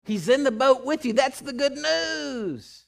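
high, loud preacher's cry, the second line slowed right down; fiery sermon voice; preaching. Acted. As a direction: proclaim it from the pulpit. Preaching at full force.

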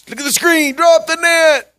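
high, loud mock order to drop the net; a hammed-up shouted command; mock call. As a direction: bark it like a gadget told you to. Mock fish-detector call.

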